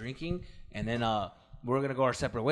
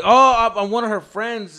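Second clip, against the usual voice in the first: flustered answer; 7 semitones higher, 11 dB louder, slower than usual. Retold or imitated.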